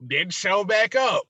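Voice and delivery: loud, high, laughing punchline; amused. Real, not acted. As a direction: burst out laughing as you say it. Laughs at being last one left.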